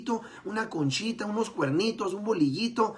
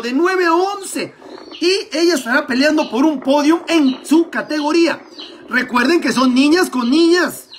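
loud, high sports commentary; live play-by-play; 4 semitones higher, 10 dB louder than usual. Acted. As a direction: call the race live and excited.

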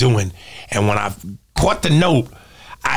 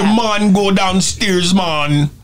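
put-on slow, higher Jamaican accent leaning hard on "man"; mock Jamaican accent; 4 semitones higher, slower than usual. Comic mocks how actors overemphasize "man", exaggerated Jamaican accent. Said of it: "put a lot of emphasis on".

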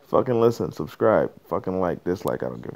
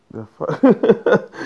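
drawn out, loud and high; exasperated outburst; 13 semitones higher, 10 dB louder than usual. Real, not acted. Ranting to listeners about Easter, loud disbelief. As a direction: stretch it out in disbelief.

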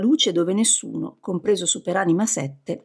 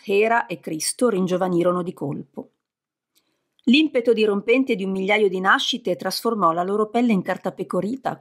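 plain reading voice

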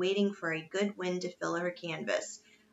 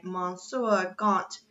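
ordinary delivery

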